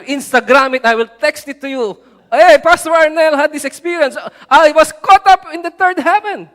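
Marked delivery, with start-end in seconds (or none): 2.3-6.5 loud, high, excited brag; hammed-up showing off; mock boastful announcement. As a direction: broadcast your big news to everyone. Imagined boastful social media post.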